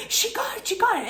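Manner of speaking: high, thrilled shouts of the friend; excited yelling. Retold or imitated. Friend yelling to the crowd that she caught it, high and excited. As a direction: squeal it out like your friend just won. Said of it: "She started yelling".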